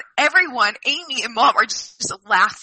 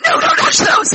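high, loud, hammed-up mock snap; exaggerated snap; 6 dB louder than usual. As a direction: overplay the sister guarding her fruit, high and loud. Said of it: "That's a bit much. I didn't do it like that".

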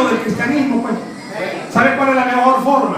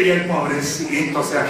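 ordinary delivery

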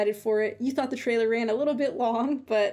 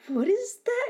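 puzzled surprise; 12 semitones higher than usual. Retold or imitated. Retold seeing the mystery envelope.

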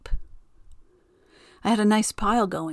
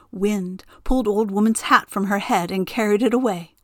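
ordinary delivery, nothing marked

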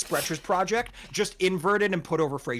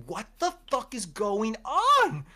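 slow, drawn-out baffled outburst; exasperated disbelief; 5 semitones higher, slower than usual. Retold or imitated. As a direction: stretch it out, totally lost for words. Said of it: "and I'm like".

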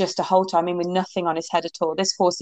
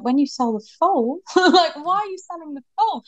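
surprised; 9 semitones higher than usual. Retold or imitated. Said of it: "I was like".

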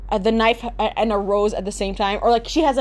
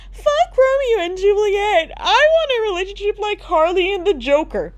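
mocking caption voice; 8 semitones higher than usual. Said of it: "they usually put captions like".

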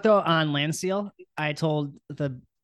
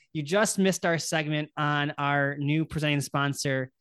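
usual speaking voice

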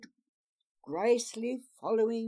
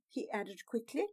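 ordinary delivery

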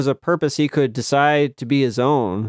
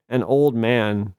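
plain reading voice

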